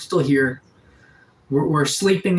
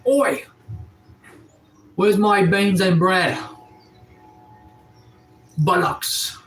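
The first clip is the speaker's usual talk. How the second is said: mock British accent, pitched up; 4 semitones higher, slower than usual. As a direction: put on a playful British accent on request. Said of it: "Do a British accent".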